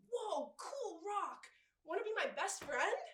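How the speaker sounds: put-on high, drawn-out child's wonder; slow wowed opening, then eager high question; kindergartner. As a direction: gush like an impressed little kid. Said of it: "You know how in kindergarten".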